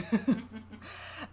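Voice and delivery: high chuckling laugh. Real, not acted. Reader amused by the guilty look, chuckling. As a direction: let a soft, high laugh out.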